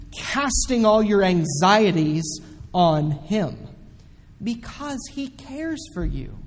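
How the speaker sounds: usual speaking voice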